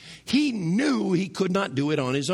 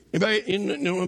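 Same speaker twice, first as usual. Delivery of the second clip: ordinary talk, nothing marked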